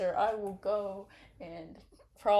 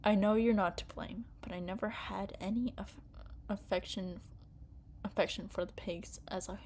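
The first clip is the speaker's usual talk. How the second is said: story narration; 4 dB quieter than usual. A narrator's reading. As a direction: read the scene quietly to yourself.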